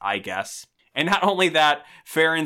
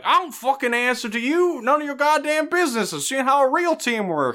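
much higher, mocking angry snarl; angry racer; 12 semitones higher than usual. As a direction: spit it at her like a jerk rival. Angry racer confronts Andy.

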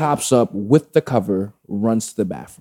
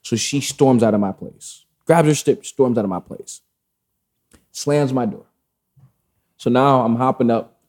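usual speaking voice

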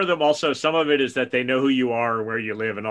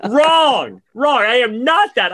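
higher, louder indignant outburst at the ad; mock outrage; 10 semitones higher, 5 dB louder, much slower than usual. Insulted by a Facebook ad, mock furious outburst. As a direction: rant back, offended and half laughing. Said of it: "And I was like".

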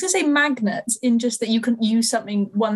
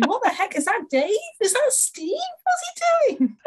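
much higher, baffled monkey voice; 14 semitones higher than usual. Retold or imitated. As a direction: squeal it out as a confused monkey. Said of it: "all these monkeys going".

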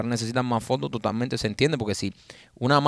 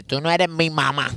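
mock protest of a child, higher pitched; defiant scolded kid; 4 semitones higher, slower than usual. Scolded pupil talking back to the teacher, mock defiance. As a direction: talk back like a scolded schoolkid. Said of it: "de que tú regañas".

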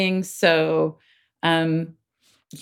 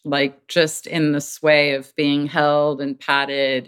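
ordinary delivery

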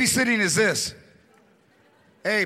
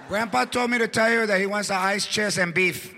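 usual speaking voice

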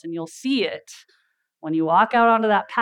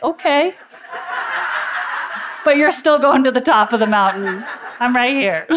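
laughing retort; 5 semitones higher, 5 dB louder than usual. Retold or imitated. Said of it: "And I'm like".

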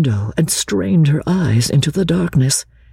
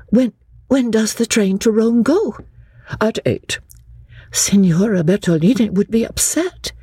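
put-on high, earnest young woman's voice; a girl standing up to her cousin, higher; young Lucy; 10 semitones higher than usual. Lucy answering her cousin Charlotte, earnest and high.

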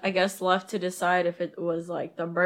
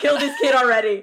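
exasperated; 5 semitones higher, 6 dB louder than usual. Retold or imitated. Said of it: "And I was like".

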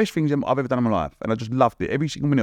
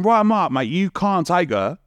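raised, higher call to Ryan Mark; calling out to stop him, pitched up; retold call-out; 7 semitones higher, slower than usual. Telling Ryan Mark he can't take her, raised. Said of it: "so I've gone".

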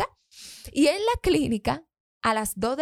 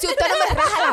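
mock threat; 12 semitones higher, 4 dB louder than usual. Retold threat to the doctor.

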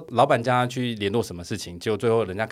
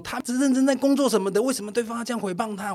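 higher, whiny aggrieved voice; aggrieved coworker; 11 semitones higher than usual. Retold or imitated. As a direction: mimic her playing the victim. 转述女同事委屈辩解. (模仿委屈的女同事)

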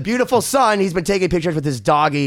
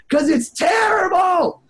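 mock outrage; 13 semitones higher, slower than usual. Real, not acted. Ranting about a bad movie.

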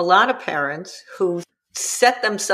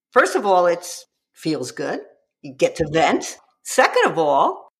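ordinary talk

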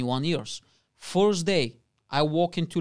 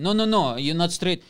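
mock-urgent queue instructions, raised voice; insistent, slightly louder stage direction; bossy scheming; 4 semitones higher, 6 dB louder than usual. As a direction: order relatives about as co-conspirators. Acts out family scheming in queue.

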